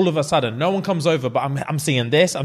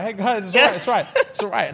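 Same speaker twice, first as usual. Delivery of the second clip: higher, laughing reassurance; soothing calm; 5 semitones higher than usual. Pretend. Referee calming angry players, high laughing reassurance. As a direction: settle the crowd of players down.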